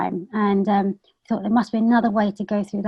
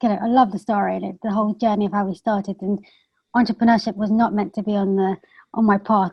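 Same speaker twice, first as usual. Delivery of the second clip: ordinary talk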